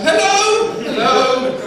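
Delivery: high chirpy posh hello; posh naive greeting. Retold or imitated. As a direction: greet everyone brightly and naively.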